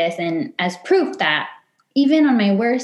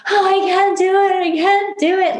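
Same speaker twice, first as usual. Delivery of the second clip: high, strained retold panic, a little louder; imitating anxious panic on the on-ramp; panicked refusal; 10 semitones higher, 3 dB louder than usual. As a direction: plead that you can't go through with it. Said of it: "Like, hating her".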